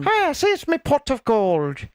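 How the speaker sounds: high, slow Irish leprechaun voice. Pretend. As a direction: gloat like a leprechaun over gold. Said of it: "I'm a leprechaun".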